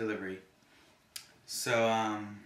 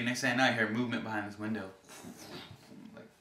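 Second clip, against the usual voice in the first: ordinary talk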